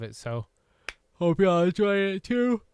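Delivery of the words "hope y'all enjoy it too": loud, high, bright sign-off; cheery. Real, not acted. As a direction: sing it out to the viewers.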